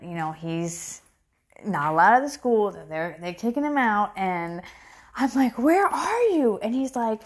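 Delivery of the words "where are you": retold alarm, pitched high; high, alarmed question; freaking out. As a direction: demand it like a worried parent, higher. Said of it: "like, freaking out".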